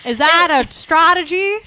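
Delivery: high, loud mock-Aussie question; bad Australian accent. Shown as a demonstration. Asking about the wide-mouth trick, hammed-up Australian accent. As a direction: ask it in a broad Australian twang. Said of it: "just open your mouth real wide".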